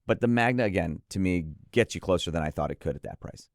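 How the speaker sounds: ordinary talk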